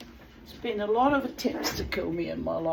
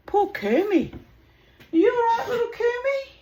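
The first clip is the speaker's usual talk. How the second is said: much higher and louder crooning pet voice; 10 dB louder than usual. Real, not acted. Owner fussing over her scared bird in high baby talk.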